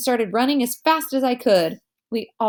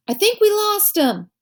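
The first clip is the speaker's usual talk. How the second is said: much higher, calling out; yelled; 12 semitones higher than usual. A narrator's reading. Boy yells to the group in the dark, high call. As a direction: yell back breathless with relief. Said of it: "someone yelled".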